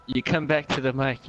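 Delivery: plain reading voice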